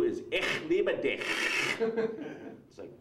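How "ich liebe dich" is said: spitty, guttural German; exaggerated German with throaty endings; harsh German. Shown as a demonstration. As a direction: hawk up the German consonants unromantically. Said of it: "the least sexy language in the world".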